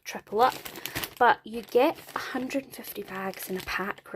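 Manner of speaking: ordinary delivery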